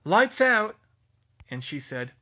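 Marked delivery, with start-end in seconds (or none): 0.1-0.8 loud shouted order; yelled. Acted. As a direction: bark it across the room. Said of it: "Percy yelled".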